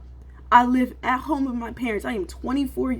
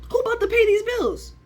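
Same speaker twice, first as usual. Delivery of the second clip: very high, put-on squeaky voice; mock the question in a cartoon pitch; silly high voice; 12 semitones higher than usual. Teasing viewers with the bills question, squeaky high voice.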